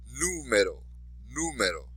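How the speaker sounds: Número with "Nú" stressed, then falling away; stress on accented syllable. Shown as a demonstration. Teacher modelling for repetition, Número stressed on "Nú". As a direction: lean on the first syllable of Número. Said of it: "be sure to emphasize the accented syllable".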